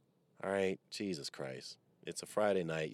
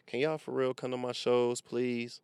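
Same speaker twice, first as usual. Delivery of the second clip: louder, higher, begging the audience to come; emotional plea; 8 semitones higher, 9 dB louder than usual. Real, not acted. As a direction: plead with real feeling to come to the shows. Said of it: "I'm getting emotional now".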